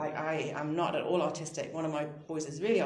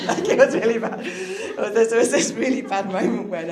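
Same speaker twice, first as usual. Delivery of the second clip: loud, fast, laughing retelling; 5 semitones higher, 13 dB louder than usual. Real, not acted. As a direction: spill it out between laughs. Laughing about swearing after injury.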